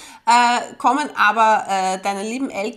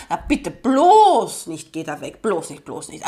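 mock warning voice; 6 semitones lower, 3 dB louder than usual. Mocking voice warning off.

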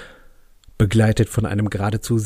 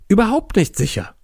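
hammy stage actor; 8 semitones higher than usual. Acted. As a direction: proclaim it like a tragic actor. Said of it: "voller theatralischer Gravitas".

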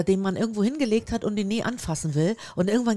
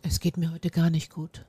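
soft, downcast excuse; sad-voiced excuse; 3 semitones lower, 7 dB quieter than usual. Shown as a demonstration. Friend demonstrating an excuse for skipping coffee, sad-voiced. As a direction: put a sorrowful look into the voice. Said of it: "diesen traurigen Blick, den du in die Stimme legst".

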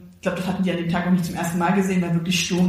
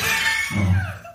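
laughs. Real, not acted. Laughing at own confession.

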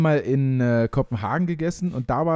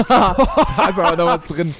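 laughing; 4 semitones higher, 5 dB louder, slower than usual. Real, not acted. Nose-breathing test, laughing.

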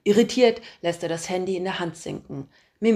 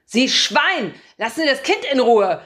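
put-on loud, raised old-man shout; old man yelling; 7 semitones higher, 8 dB louder than usual. A narrator's reading. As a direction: bellow at the stranger in outrage. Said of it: "schreit er mir zu".

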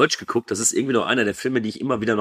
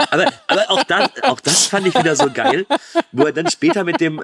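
laughing; 8 semitones higher, 3 dB louder than usual. Real, not acted. Laughing while recalling scene.

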